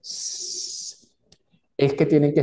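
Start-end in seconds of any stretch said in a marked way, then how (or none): 0.0-1.1 long unvoiced hiss of air; hissing s like escaping air. Shown as a demonstration. Teacher hissing like a leaking ball. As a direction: let the air out through your teeth. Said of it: "cuando a una pelota ... le escapa el aire".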